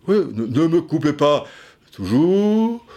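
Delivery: lightly voiced Mitterrand, rising on the last word; hint of Mitterrand's stately tone; Mitterrand impression. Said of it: "c'est un peu Mitterrand".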